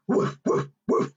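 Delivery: airy, short puffed woofs; pretend barking. Presenter in a dog mask for children, pretend barking. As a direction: bark like a playful dog. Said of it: "we can all say woof".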